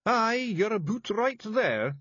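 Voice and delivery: high, thick Scots pixie voice; small tough Scots fairy breaking bad news; Scottish Feegle voice. A narrator's reading. Feegle reassuring.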